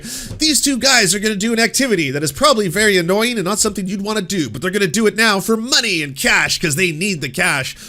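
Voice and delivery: mocking game-show host energy; mock YouTuber narration. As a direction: parody a challenge video intro. Mocking MrBeast video narration.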